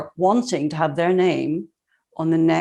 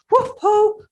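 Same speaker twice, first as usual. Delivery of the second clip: a sudden high cheer; a spontaneous high-pitched whoop of praise; excited whoop; 4 dB louder than usual. Real, not acted. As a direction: whoop it up with delight.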